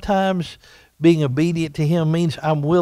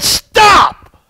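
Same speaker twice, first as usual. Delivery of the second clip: very high, loud yell; sharp shout; 8 dB louder than usual. Retold or imitated. Neighbor lady finally losing patience with Johnny, shouting. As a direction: stop him dead with one cry. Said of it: "she yelled at him and said".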